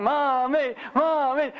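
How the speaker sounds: high imitated kids' chant; children yelling. Kids drowning out their mother's answer, imitated yelling. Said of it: "they were yelling in the back".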